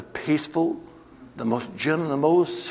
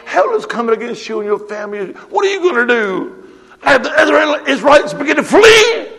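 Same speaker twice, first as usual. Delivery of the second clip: rising preaching; 11 semitones higher, 10 dB louder than usual. Acted. Preacher rallies congregation.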